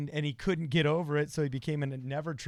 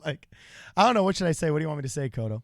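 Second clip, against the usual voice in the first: ordinary talk, nothing marked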